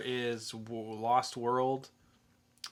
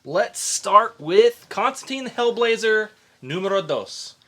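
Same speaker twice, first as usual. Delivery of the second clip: big hyped intro; 9 semitones higher, 12 dB louder than usual. Real, not acted. Host kicking off the first review, loud and hyped. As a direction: announce the first book like a big reveal.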